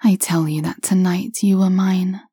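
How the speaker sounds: plain reading voice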